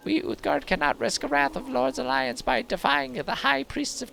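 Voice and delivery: high, put-on old crone voice; old wise woman voice.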